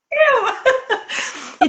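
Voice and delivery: high-pitched laughing admission. Real, not acted. Seller owning up that it's her, laughing. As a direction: burst out laughing as you own up.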